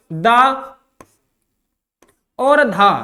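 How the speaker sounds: दा and धा drawn out slowly, with pauses between; slow syllable demo of दा and धा; contrasting syllables. Shown as a demonstration. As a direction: set दा apart from the breathy धा, slowly. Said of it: "दा और धा का जो उच्चारण".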